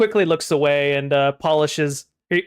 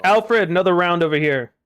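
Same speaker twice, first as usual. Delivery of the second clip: calling out; 3 semitones higher, 4 dB louder than usual. Acted. Patron orders another round from the bartender, calling out. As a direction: call over to someone at a distance.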